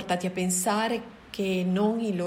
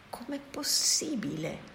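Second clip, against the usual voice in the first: breathless unvoiced whisper, slow; inner whisper; 5 semitones higher than usual. A narrator's reading. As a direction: whisper it to yourself in disbelief. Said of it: "dissi dentro di me".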